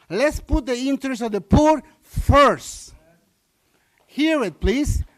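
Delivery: pleading loudly with the board; impassioned plea. Real, not acted.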